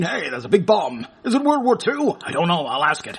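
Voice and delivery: ordinary talk, nothing marked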